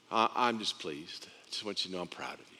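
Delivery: low, very soft confession; tender, hushed affirmation; quiet fatherly pride. Acted. Father voices pride in Son.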